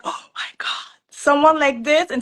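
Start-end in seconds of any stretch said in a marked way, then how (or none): 0.0-0.7 whispered awe; retold whisper. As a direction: breathe it out in amazement. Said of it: "I'm like".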